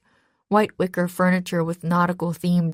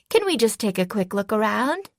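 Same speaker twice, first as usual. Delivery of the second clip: put-on lilting, pampered teen tone; bratty daughter lilt; 3 semitones higher than usual. A narrator's reading. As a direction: ask like a spoiled rich girl. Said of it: "I added an extra lilt to my voice".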